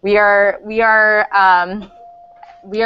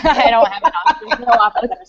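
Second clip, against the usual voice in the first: laughing; 5 semitones higher than usual. Real, not acted. Laughing about having no office.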